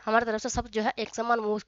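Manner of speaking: normal talking voice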